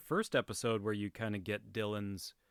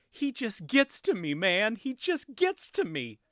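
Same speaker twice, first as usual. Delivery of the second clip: higher hammed-up tearful whine; mock crying Dylan; 11 semitones higher, 5 dB louder than usual. Co-host hears Dylan's overdone sob mocked, higher and whiny. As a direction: ham up Dylan's bad crying scene. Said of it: "he starts crying".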